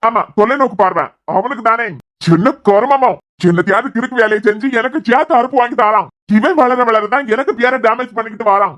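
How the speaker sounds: loud, exasperated complaining; annoyed grumbling. Acted. Son scolds about the mattress.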